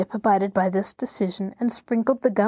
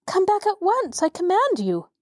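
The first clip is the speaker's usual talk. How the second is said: commanding call; 10 semitones higher than usual. A narrator's reading. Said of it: "cried Tip in a frightened voice".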